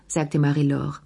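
ordinary delivery

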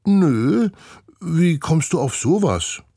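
put-on high, sing-song innocent girl's voice; feigned innocence. Nina answering Olaf, pretending to be innocent. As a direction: play dumb with wide-eyed innocence. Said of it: "tun unschuldig".

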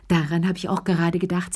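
ordinary delivery, nothing marked

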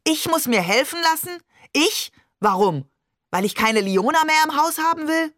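high-pitched outraged questions; incensed, incredulous outburst; exploding. A narrator's reading. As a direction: burst out in indignant disbelief, pitch leaping up. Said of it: "Barbe explodierte".

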